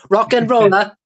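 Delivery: mock rock-star catchphrase; playful catchphrase. Repeats the catchphrase on cue.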